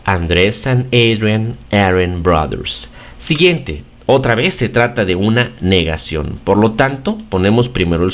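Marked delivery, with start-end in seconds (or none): 0.1-2.9 lento y claro. Shown as a demonstration. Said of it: "modelo de oración en inglés".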